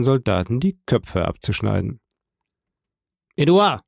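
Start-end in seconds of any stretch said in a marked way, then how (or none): 3.4-3.8 sharp, loud, high call of a name; stern father snapping at his son; harsh call. A narrator's reading. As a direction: bark the boy's name hard. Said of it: "mit so hartem Ausdruck".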